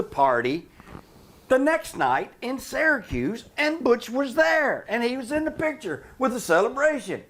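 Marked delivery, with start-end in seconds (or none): 1.5-7.2 emphatic insistence. Real, not acted. Setting straight Butch's photo claim, emphatic.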